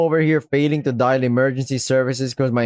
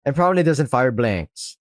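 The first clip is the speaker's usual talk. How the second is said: ordinary delivery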